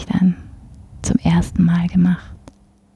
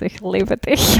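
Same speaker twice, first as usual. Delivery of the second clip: joking Meidling dialect; slower than usual. Pretend. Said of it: "Das ist eine Meidlinger".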